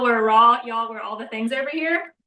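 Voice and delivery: normal talking voice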